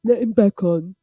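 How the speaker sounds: loud, sneering mock of the community; mocking quote. Mocking the boxing community who unblock him. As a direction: jeer it like the fools who let him back. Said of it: "They block him and then they go".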